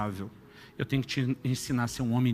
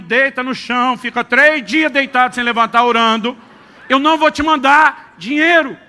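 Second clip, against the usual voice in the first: loud, high, emphatic pulpit delivery; preaching at full force; 8 semitones higher, 11 dB louder than usual. Acted. Pastor tells the church about his son, preaching loudly. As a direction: preach it out to the whole congregation, full power.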